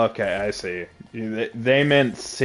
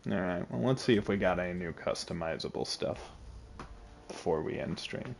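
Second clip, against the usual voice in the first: tired monotone; 3 semitones lower, 9 dB quieter than usual. Real, not acted. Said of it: "now I sound more monotone because I'm tired".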